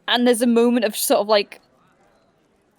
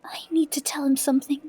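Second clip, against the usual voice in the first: urgent whisper. Acted. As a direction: hush it out. Whispered in character.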